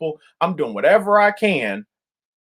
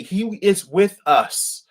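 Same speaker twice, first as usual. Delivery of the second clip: He is with us, slowed, each word weighed; slow emphatic line; 3 semitones higher, much slower than usual. Acted. Preacher to his brothers and sisters, slow and emphatic. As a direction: land it slowly, word by word.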